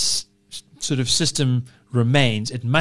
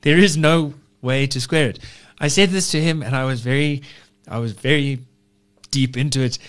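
ordinary talk

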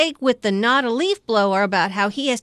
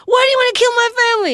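angry yell; 12 semitones higher, 6 dB louder, much faster than usual. Retold or imitated. Hero yells at alien.

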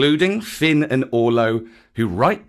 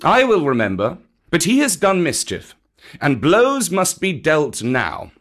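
firm, raised voice of a stern panther; Bagheera; 6 semitones higher, 4 dB louder than usual. Acted. Bagheera insists on punishing the cub, stern. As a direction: lay down the sentence firmly.

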